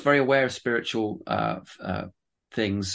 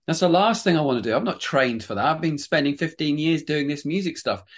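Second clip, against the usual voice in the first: higher-pitched mock protest; retold protest; 5 semitones higher than usual. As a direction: recount your reluctant objection, pitch raised. Retold protest at church leadership call.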